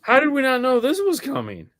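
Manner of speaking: high-pitched incredulous question; exaggerated bewildered outcry; mock disbelief. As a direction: act out a baffled, caught-off-guard reaction. Voicing stunned storm victims.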